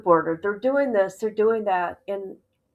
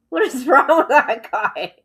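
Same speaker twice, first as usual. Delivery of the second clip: real amused disbelief; laughing in disbelief; 5 dB louder than usual. Real, not acted. Host mocking the YouTuber's words, laughing. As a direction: laugh bursting out mid-question, a bit louder.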